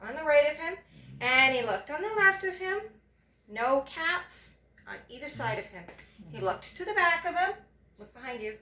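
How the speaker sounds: lively storybook reading, rising high and loud on the action; reading to a child. A narrator's reading. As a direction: read it like a bedtime picture book for a little one.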